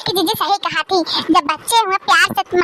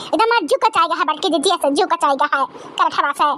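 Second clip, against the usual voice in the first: usual speaking voice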